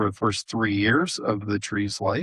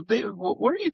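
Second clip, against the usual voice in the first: much higher, incredulous questioning voice; puzzled Honi; 12 semitones higher, much faster than usual. Retold or imitated. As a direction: push the question up in disbelief.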